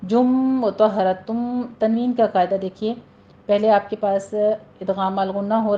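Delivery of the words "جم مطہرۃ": slow, melodic tajweed chant; Quran recitation. Acted. Teacher recites the verse to her students, slow chant. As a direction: recite the verse slowly and tunefully.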